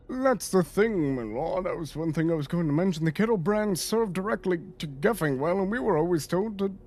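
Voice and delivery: anxious in-character underling; deferential subordinate, worried. Acted. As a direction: raise a concern respectfully to your lord. Plays a subordinate reporting to his lord.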